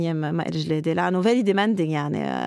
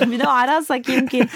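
teasing through laughter, louder; laughing tease; 6 semitones higher, 5 dB louder than usual. Real, not acted. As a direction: jokes at him, laughing. Teasing the host while laughing. (مزاح مع ضحك)

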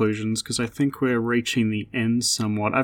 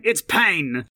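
mock cheesy New York gangster, raised pitch; New York gangster accent; 5 semitones higher than usual. Enemy spotting Payne as you enter a room, mock New York gangster accent. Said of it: "cheesy New York gangster accents".

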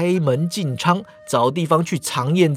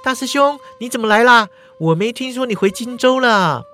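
put-on higher, bright-eyed girl greeting her senior; a lively young heroine, sweetly surprised; cheerful young woman; 6 semitones higher, 4 dB louder than usual. Female character Shangguan Mingyue.

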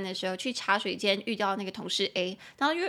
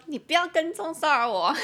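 playful warning, higher and louder; mock warning; 7 semitones higher, 5 dB louder than usual. Jokingly warning a foreigner not to stalk her, mock-stern. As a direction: warn them off, not seriously. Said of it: "就警告他说".

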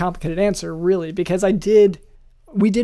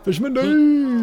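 cheering shout; 7 semitones higher, 5 dB louder than usual. Acted. Joining the cheer.

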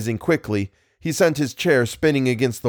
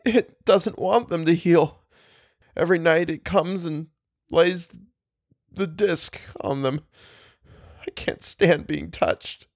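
anguished; 7 semitones higher than usual. A narrator's reading. Tormented Ullman pleading.

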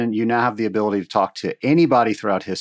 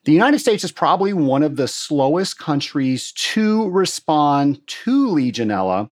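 slightly higher, announcer-style closing read over music; podcast outro read; 5 semitones higher than usual. Acted. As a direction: wrap up the show like an announcer.

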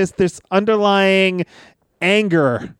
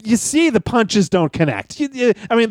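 ordinary talk

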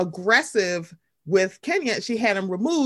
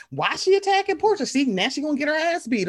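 higher mock indignant fans; 6 semitones higher than usual. Imitating viewers defending Portia, higher mock indignation. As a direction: play the indignant viewers, pitched up.

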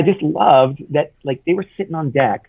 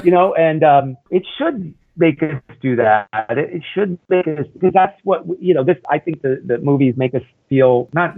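usual speaking voice

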